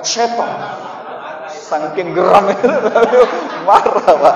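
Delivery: laughing through the words, loud; laughing loudly. Real, not acted. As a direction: burst out laughing while naming anger. Laughing at angry devil. (tertawa keras sambil bicara)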